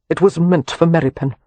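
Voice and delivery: ordinary delivery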